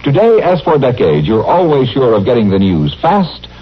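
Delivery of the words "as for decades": normal talking voice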